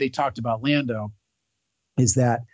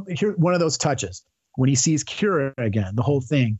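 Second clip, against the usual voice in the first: ordinary talk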